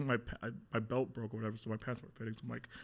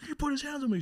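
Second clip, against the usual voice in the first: high, loud retold protest; imitating a man yelling in outrage; indignant complaint; 5 dB louder than usual. Man complaining to the boy's mother, indignant yell.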